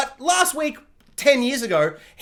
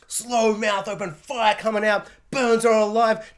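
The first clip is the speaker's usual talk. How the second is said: usual speaking voice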